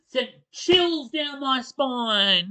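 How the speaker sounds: slow shaken. Real, not acted. Shaken by the scary story.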